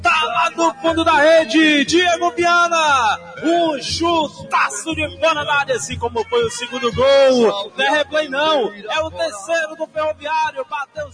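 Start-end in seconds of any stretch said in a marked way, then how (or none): 0.0-9.9 held goal scream then call; explosive goal commentary. Acted.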